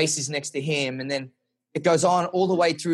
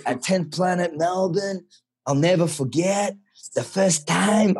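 slow mock Portuguese accent; slow it down in a put-on Portuguese accent; bad Portuguese accent; 3 semitones higher, slower than usual. Quoting the professor on his seminar in a bad Portuguese accent. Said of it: "Portuguese accent".

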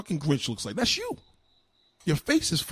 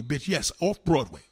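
normal talking voice

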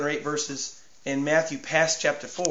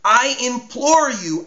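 raised, higher pleading cry of the demon; loud cry; 5 semitones higher, 4 dB louder than usual. Acted. Demon cries out loudly to Jesus, begging. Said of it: "cried out with a loud voice".

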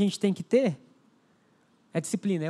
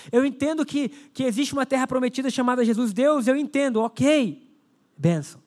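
fervent preaching; 4 semitones higher than usual. Acted. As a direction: pour out the prayer with fervour.

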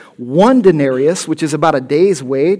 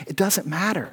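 ordinary talk

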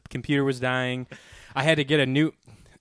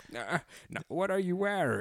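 Bane voice; 4 semitones higher, 7 dB quieter than usual. Pretend. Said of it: "He's like".

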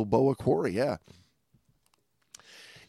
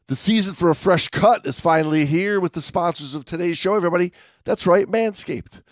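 upbeat, higher pitched sponsor read; 6 semitones higher than usual. Acted. Host pitching the sponsor to podcast listeners, upbeat ad-read voice. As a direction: sell it like a radio ad, grinning. Reads sponsor ad mid-banter.

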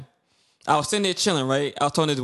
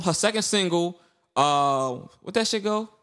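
ordinary delivery

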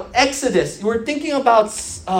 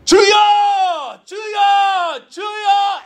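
yelling at top of lungs; 11 semitones higher, 5 dB louder than usual. Shown as a demonstration. Older Koreans opening prayer, yelled at full volume. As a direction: bellow it to heaven with passion, high and loud. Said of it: "They yell it at the top of their lungs".

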